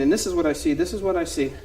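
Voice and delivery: ordinary delivery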